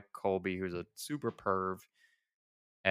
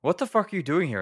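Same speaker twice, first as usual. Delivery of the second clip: high, loud, fast mock outrage; hammed-up furious shriek at the intruder; 9 semitones higher, 7 dB louder, faster than usual. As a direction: fire it off like a startled accusation. Acting out angry actress.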